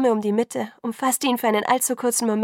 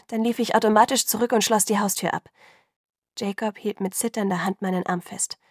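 ordinary delivery

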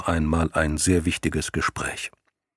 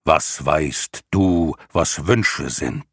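very slow, booming lion; rumbling lion voice; 2 semitones lower, much slower than usual. Acted. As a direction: let the ground shake with it. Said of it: "eine Stimme, die wiederum den Boden vibrieren ließ". (langsame, dröhnende Löwenstimme)

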